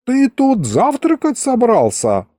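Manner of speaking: put-on high-pitched amazed old grandpa; astonished. A narrator's reading. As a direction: drag out the incredulous question, voice up high. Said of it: "поразился дед". (изумлённо, высоким голосом)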